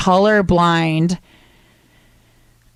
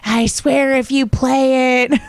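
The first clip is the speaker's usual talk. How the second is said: slow, higher threatening warning; mock threat; 6 semitones higher, slower than usual. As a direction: drag out the threat, half joking. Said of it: "that's when she's like".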